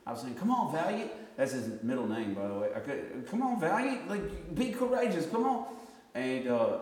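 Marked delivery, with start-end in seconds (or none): 0.4-1.1 high, insistent encouragement; retold urging. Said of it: "vanquish fear and panic". 3.3-5.7 high, insistent pep talk; retold urging. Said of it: "vanquish fear and panic".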